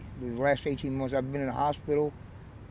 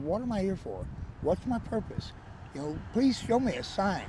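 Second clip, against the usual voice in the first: higher-pitched retold plea to God; pleading prayer; 5 semitones higher, 4 dB quieter than usual. Homeless man asking the Lord his purpose, pleading. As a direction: re-enact asking the Lord for guidance. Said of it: "asking the Lord".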